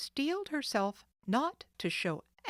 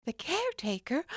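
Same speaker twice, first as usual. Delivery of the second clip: alarmed question; 8 semitones higher, faster than usual. Acted. Nancy alarmed.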